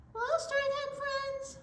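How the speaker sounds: very high, squeaky puppet voice; giraffe character. Pretend. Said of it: "Giraffe would like to join us".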